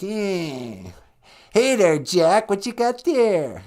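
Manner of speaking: high, drawn-out goblin squeal. Acted. Goblin calls to Jack on the bridge, high creature voice. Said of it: "He's like".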